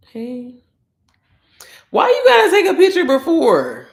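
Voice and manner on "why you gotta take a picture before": high, loud yell through laughter; laughing protest. Real, not acted. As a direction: holler a mock complaint, laughing.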